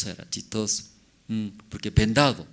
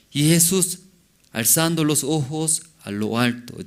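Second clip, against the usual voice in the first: ordinary talk, nothing marked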